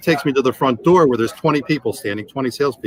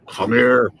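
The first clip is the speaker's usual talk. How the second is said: retold yell. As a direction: imitate a boss's order without full volume. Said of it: "And he's yelling at me".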